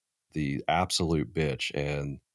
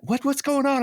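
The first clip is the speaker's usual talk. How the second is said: confused wife; 4 dB louder than usual. Retold or imitated. Wife's confused reply, retold.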